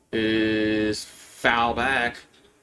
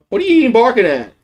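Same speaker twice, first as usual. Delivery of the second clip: high, loud scolding call; yelling at the dog; 10 semitones higher, 6 dB louder, much faster than usual. Real, not acted. Owner shouting at his barking dog, loud and high. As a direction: bark back at the dog to hush it.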